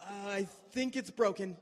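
"I think it's broken" said loud and high in pain; imitating Justin. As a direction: wail "I think it's broken" like the injured friend. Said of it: "And he goes".

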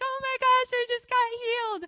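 very high, loud imitated joy; excited squeal. Healed girl jumping up and down, excited imitated squeal. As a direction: shriek it like someone just healed. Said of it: "but really excited".

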